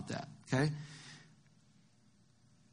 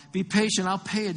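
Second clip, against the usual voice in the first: mildly urgent plea, higher and louder; pleading; 5 semitones higher, 5 dB louder than usual. A narrator's reading. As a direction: plead for a little more time. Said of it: "he pleaded".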